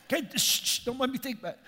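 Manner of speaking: high, hushed mock-tearful plea; mock choked-up. Husband trying not to think about movie, mock tearful. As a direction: hush yourself as if about to cry. Acts holding back tears.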